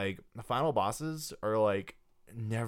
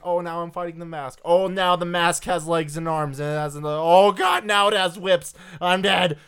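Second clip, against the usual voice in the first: rising panic, getting louder and higher; panicking gamer; 6 semitones higher, 8 dB louder than usual. Retold or imitated. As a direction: build from worried to frantic. Player panicking at boss fight.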